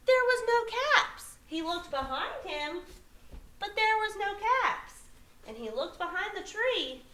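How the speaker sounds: expressive storybook reading with lifted stresses; story read to children. A narrator's reading. Teacher reading a picture book to young children, animated storytelling. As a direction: read it aloud, drawing out the big moments.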